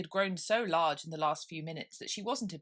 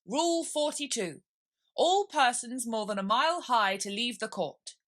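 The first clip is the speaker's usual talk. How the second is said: raised, loud royal proclamation; pompous king; 6 semitones higher, 6 dB louder than usual. Acted. King reading a made-up rule to the court, loud and pompous.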